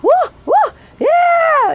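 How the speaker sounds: high, loud whoops; screaming tics. Shown as a demonstration. Showing viewers her screaming tics, high whoops. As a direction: demonstrate high whooping tic outbursts. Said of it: "I'm having screaming ticks".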